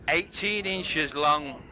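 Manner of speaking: slow, deliberate punchline. Acted. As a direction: drag out each word for the laugh. Punchline slowed.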